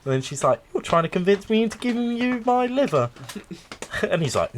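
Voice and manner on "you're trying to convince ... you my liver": much higher suspicious wife voice; mock-wary accusation; wife quote. Retelling the liver sketch, high and wary.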